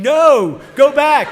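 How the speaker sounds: frantic shout at the heifer; panicked loud yell; screaming. Retold or imitated. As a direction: yell it, desperate to stop her. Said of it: "I'm screaming".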